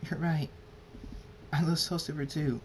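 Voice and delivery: tearful concession. A narrator's reading. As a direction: give in, voice lifted as if near tears. Tearful character gives in.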